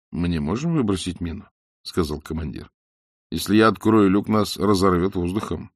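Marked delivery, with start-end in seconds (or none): none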